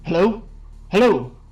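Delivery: calling out loudly. Acted. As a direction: call out into the dark. Calling out for help.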